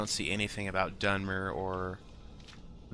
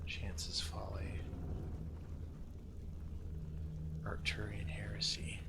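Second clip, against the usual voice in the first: softly whispered names; 11 dB quieter than usual. Real, not acted. Gamer whispering place names to himself. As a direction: breathe the names out under your breath. Reading map names while playing.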